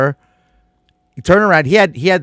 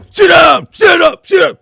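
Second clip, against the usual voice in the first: barked order, much higher and louder; drill sergeant yell; 11 dB louder than usual. Retold or imitated. Bus driver ordering kids to stand, drill sergeant yell.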